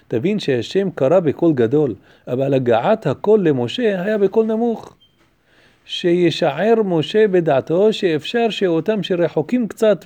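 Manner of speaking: loud, rapid recitation of a text; reading Hebrew commentary aloud. A narrator's reading. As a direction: read the Hebrew out briskly and emphatically.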